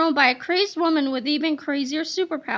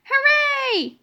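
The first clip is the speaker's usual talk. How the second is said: put-on children's cheer; 9 semitones higher, 4 dB louder than usual.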